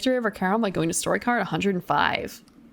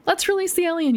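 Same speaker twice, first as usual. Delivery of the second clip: higher, eager push; eager suggestion; 9 semitones higher than usual. Acted. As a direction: pitch it up keen to free the alien. Player character quoted eagerly.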